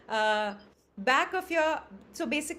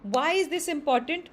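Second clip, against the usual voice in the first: ordinary talk, nothing marked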